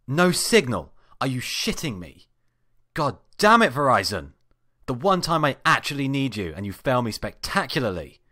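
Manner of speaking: loud, high, disbelieving rant at the phone; furious outburst. Acted. Stranded man yelling at his phone about no signal, furious shout. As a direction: blow up at the carrier, shouting. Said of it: "I stared up at it in horror".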